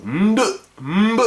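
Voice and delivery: Nde, mbe said high and loud, nasal onsets stressed; African prenasalised sounds. Shown as a demonstration. Teacher stressing African sounds to the class, high and loud. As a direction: call out Nde and mbe clearly, nasal before the stop. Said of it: "propres aux langues négro-africaines".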